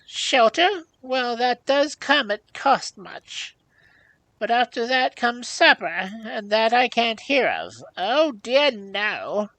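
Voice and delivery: high, loud, carping crone; grudging old hag. A narrator's reading. Old hag grudging a guest supper, carping.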